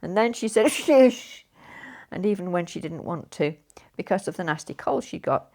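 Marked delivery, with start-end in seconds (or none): 0.7-1.5 loud shush. Acted. Child shushing.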